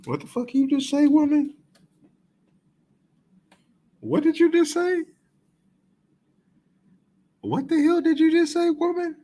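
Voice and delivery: high, disbelieving outburst; shocked, demanding what she just said; incredulous. Real, not acted. Reacting in disbelief to clip.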